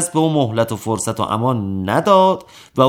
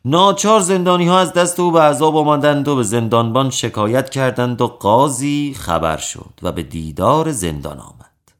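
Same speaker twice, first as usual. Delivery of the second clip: usual speaking voice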